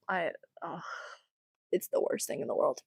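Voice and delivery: low, near-whispered groan of despair; defeated mutter. Real, not acted. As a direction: sink into a tired, hushed moan. Groaning at lunch table memory.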